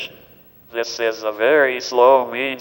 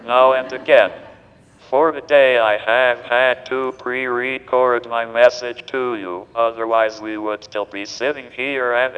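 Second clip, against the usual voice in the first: synthetic text-to-speech voice; digitized voice. Real, not acted. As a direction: speak like a computer speech device. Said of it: "this digitized voice just does not seem to quite".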